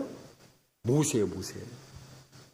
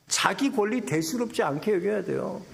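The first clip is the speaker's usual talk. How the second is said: ordinary delivery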